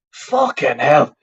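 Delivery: usual speaking voice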